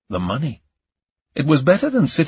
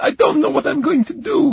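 very high, fast tearful wail; crying; faster than usual. Acted. Camellia breaks down to Ion on the phone, crying. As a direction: wail it as tears start. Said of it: "Now she began to cry".

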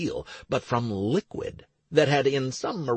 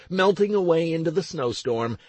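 ordinary delivery, nothing marked

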